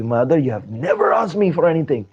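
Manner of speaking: delighted eagerness rising in pitch; acted happy, eager devotion. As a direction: build into an overjoyed offer. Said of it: "Hanumanji became so happy".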